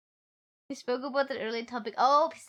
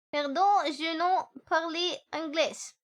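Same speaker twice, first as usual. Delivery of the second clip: slow, higher fake French accent; mock French; 5 semitones higher, slower than usual. Shown as a demonstration. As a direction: play a haughty French waiter. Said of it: "I understand that you do not speak".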